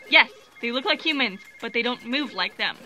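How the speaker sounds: normal talking voice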